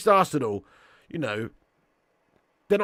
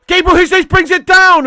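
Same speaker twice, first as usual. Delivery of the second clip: much higher, much louder match call; excited shout; 20 dB louder than usual. Real, not acted. Streamer calling the match live to chat, excited shout. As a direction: yell it like the striker is through on goal.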